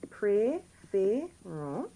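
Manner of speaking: "Préférons" very slowly, in three rising syllables; teacher's drawn-out breakdown of "Préférons"; acute accents. Shown as a demonstration. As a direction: sound out "Préférons" with gaps between syllables. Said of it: "They're just acute accents".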